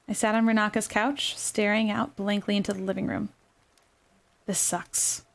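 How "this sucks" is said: soft, defeated muttering; sighing it out under breath; dejected mutter. A narrator's reading. Dejected inner thought.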